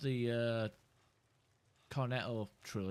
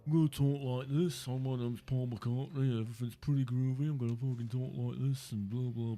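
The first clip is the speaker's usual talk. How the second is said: mocking Liverpool accent, louder; 3 semitones higher, 5 dB louder than usual. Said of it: "that's what all people from Liverpool sound like".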